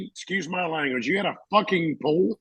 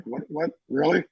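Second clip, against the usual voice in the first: incredulous; 3 dB quieter than usual. Retold or imitated. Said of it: "and I was like".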